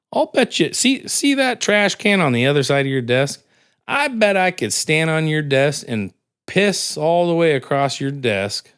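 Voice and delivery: lightly voiced old hustler boasting; sly high old-timer; old man voice. Old guy voiced in joke.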